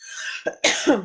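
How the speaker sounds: real coughing fit; choking cough. Real, not acted. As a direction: cough after swallowing wrong. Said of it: "j'ai avalé de travers".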